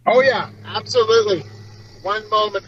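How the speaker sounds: normal talking voice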